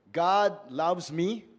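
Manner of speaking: God loves me said slowly and firmly, word by word; a slow, weighty affirmation; slow emphatic declaration. Shown as a demonstration. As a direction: lay each word down deliberately. Preacher models a simple confession.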